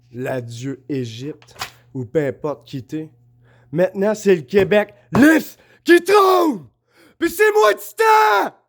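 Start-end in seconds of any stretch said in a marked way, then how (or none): 3.7-8.6 slow, much higher and louder, a triumphant shout; proclaiming shout. Real, not acted. Vlogger proclaiming himself Titan to his viewers, shouting. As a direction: bellow it out like a crowning decree. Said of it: "vous m'entendez crier dans vos têtes".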